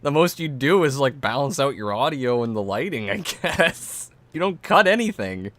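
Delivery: loud, high disbelief; incredulous. Real, not acted. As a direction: scoff in amused disbelief. Incredulous laughing rant.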